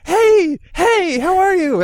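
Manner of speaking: put-on high, loud baby talk to a dog. Son greeting the dog on the phone, high excited baby talk. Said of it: "picture that he's put the phone next to the dog's ear and go".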